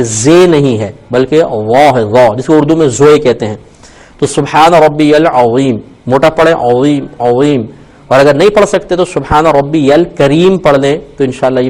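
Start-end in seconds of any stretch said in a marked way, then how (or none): 4.2-7.6 عظیم with a thick, full ظ; heavy ظ sound. Shown as a demonstration. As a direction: give the ظ in العظیم a heavy, emphatic sound. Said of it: "واو موٹا نکالیں گے".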